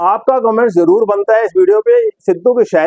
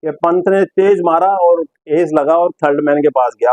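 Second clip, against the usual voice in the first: normal talking voice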